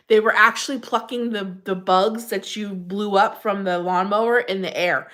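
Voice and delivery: ordinary delivery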